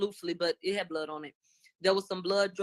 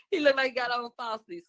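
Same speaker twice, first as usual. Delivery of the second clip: laughing; 5 semitones higher, 5 dB louder than usual. Real, not acted. Laughing while joking about eyelashes.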